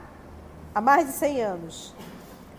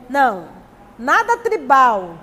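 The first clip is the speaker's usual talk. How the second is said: emphatic no; 8 dB louder than usual. Real, not acted. Nega com ênfase.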